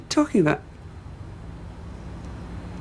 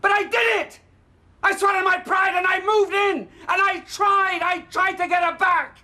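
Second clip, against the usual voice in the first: bitter angry rant; 5 semitones higher than usual. Acted. Father raging at his daughter about her mother, building to shouting. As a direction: pour out years of humiliation, rising to a roar.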